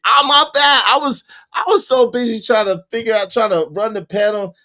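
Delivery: high, loud, hurried excuse; flustered apology. Real, not acted. Host excusing himself to chat, flustered. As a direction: wave it off, flustered.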